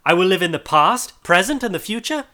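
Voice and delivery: high, loud anguished promising; agonized vow. A narrator's reading. Scrooge vowing to honor Christmas to the spirit, anguished and loud. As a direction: vow it in desperate agony. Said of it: "In his agony".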